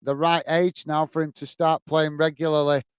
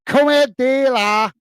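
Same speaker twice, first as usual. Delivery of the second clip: high, loud Scouse call; drawn-out Scouse holler, pitched right up; Scouse accent; 8 semitones higher, 5 dB louder, slower than usual. Shown as a demonstration. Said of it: "I do like the Scouse accent".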